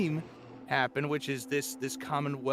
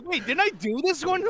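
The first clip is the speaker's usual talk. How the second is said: confused surprise; 10 semitones higher, 4 dB louder than usual. Retold or imitated. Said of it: "I'm reading this thing like".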